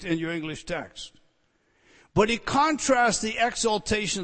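high, loud teaching from the pulpit; forceful preaching. Real, not acted. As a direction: drive the point home to the whole congregation.

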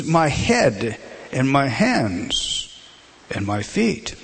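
very slow, dropping at the end; slow earnest plea. Acted. Preacher voicing Peter begging for full washing, slow plea. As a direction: draw out each part.

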